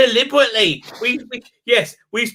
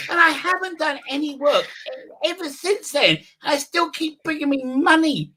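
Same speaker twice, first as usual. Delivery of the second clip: higher, prissy caricature of a smug showrunner; mock Chibnall voice; 8 semitones higher than usual. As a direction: lampoon him in a raised, airy voice.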